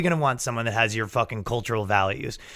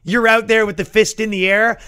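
ordinary talk

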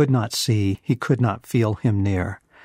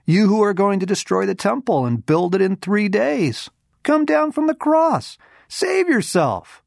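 high-pitched taunting crowd voice; jeering insults; 11 semitones higher, 4 dB louder than usual. A narrator's reading. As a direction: hurl it mockingly, pitched way up. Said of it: "hurled insults at him, shaking their heads".

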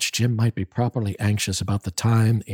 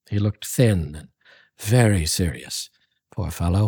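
plain reading voice

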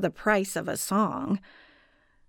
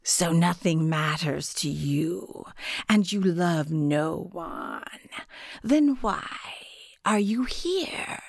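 a spirit whispering from the dark; 2 semitones lower than usual. A narrator's reading. Guardian spirit speaks from darkness.

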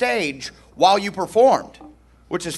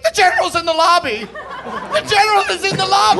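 high, fast, frantic warning; panicked; 13 semitones higher than usual. Retold or imitated. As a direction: squeal it out in a panic. Panicked USO woman, retold.

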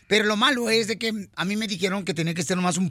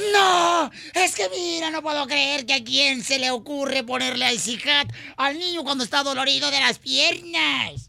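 higher, indignant wife voice, lightly put on; mock scolding wife; 6 semitones higher than usual. Husband mimics his wife's scolding, higher and indignant. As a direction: play the outraged wife, half-mocking.

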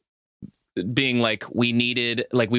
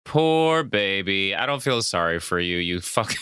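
mock pity; 6 semitones lower than usual. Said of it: "mocking the killer".

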